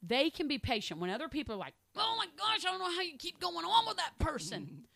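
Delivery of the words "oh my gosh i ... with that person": much higher, mock-exasperated gasp; exasperated disbelief. Mimicking impatient people, mock exasperated. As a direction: act amazed someone can keep going.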